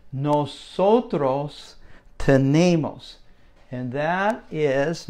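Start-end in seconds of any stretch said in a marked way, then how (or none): 0.1-3.2 nosotros drawn out very slowly, syllable by syllable; slow Spanish model. Shown as a demonstration. Teacher sounds out nosotros tenemos very slowly for students. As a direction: spell out nosotros slowly for learners. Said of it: "let's go down to the bottom".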